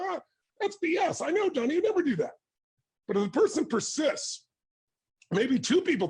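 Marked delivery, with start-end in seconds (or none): 0.0-2.3 high, scornful brush-off of gossip; scoffing dismissal. Retold or imitated. Friend waving off gossip about John, scoffing. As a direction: scoff it away like it's absurd.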